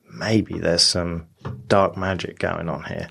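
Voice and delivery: low, slow, ominous whisper of a thought; ominous musing. Retold or imitated. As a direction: drag it out darkly, like a suspicion. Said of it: "So he's thinking".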